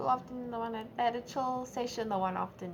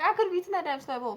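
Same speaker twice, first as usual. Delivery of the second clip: higher, louder, fast joking outburst; mock outrage; 8 semitones higher, 8 dB louder, faster than usual. Girl joking about the thrown snack, loud mock outrage. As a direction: blurt it, half-joking indignation. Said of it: "I was like".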